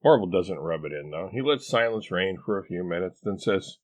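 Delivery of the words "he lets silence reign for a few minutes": usual speaking voice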